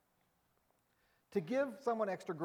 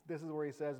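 normal talking voice

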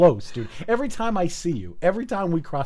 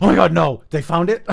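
mock panic; 3 semitones higher, 9 dB louder than usual. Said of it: "I'm like".

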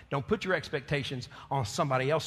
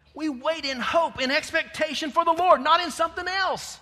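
much higher and louder, slow and ringing; preaching at full force; 9 semitones higher, 6 dB louder than usual. Acted. Preacher proclaiming Psalm thirty-three, loud and high.

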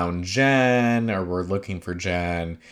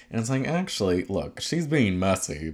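ordinary talk

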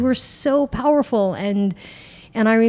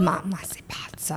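whispered Italian; 3 semitones lower, 8 dB quieter than usual. Retold or imitated. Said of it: "Like, you're crazy".

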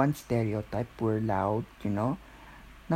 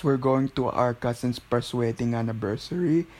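exaggerated Filipino accent, "first" as "pers"; playful Filipino-accent parody, B for V in anniversary; mocking Filipino accent. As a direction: mimic a comic Filipino-accented announcement. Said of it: "making fun of Filipino accent".

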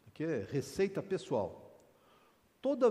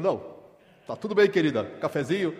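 loud, sing-song cheerful offering; hammed-up sweet husband; cheery offer; 8 dB louder than usual.

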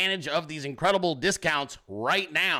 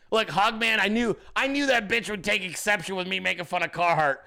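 ordinary delivery

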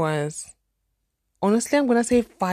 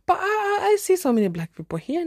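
high polite voice; 12 semitones higher than usual. Retold or imitated. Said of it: "I said".

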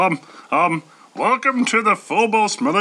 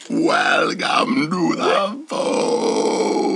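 inhaled, slow speech; breathing in; 2 semitones lower, much slower than usual. Pretend. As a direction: talk while breathing in. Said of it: "how about breathing in".

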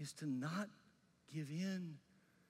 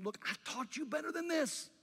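much higher, louder scolding; exasperated rebuke; 9 semitones higher, 7 dB louder than usual. Retold or imitated. Paul scolding the church that strayed, exasperated loud rebuke. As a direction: rebuke them like a let-down teacher. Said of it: "he's saying to these people".

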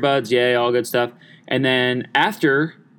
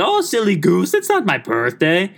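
higher, teasing put-on lady voice; mock older lady laughing off; 6 semitones higher than usual. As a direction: tease him like a giggly older lady. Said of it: "She's like".

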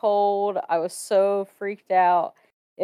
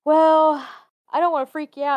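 hesitant waitress; 8 semitones higher, 5 dB louder than usual. Retold or imitated. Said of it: "she's like".

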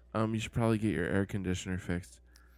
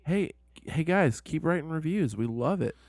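higher, louder hype-man pitch; hyped plea to fans; 7 semitones higher, 4 dB louder than usual. Acted. Cohost begging listeners for five-star reviews, hyped. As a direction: sell it to the fans like an ad.

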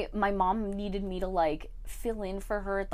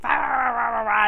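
loud, high blah-blah mimicry; dismissive imitation of someone going on; mock ranting; 4 semitones higher, 8 dB louder than usual. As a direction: rattle off nonsense like a worked-up rant. Nonsense mimicry of mother's rant.

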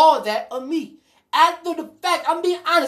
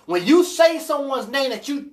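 ordinary talk, nothing marked

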